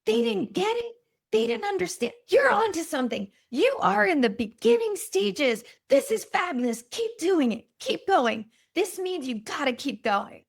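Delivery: high-pitched, emphatic imitation of a cheering dad; retold excited pep talk. Father talking his daughter out of quitting, acted-out excited encouragement. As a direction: urge her on like an excited father.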